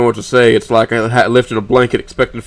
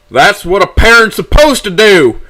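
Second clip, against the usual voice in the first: loud, high, drawn-out angry yell; scream; 10 semitones higher, 7 dB louder, slower than usual. A narrator's reading. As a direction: scream it at the phone in outrage. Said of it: "I scream towards the handset".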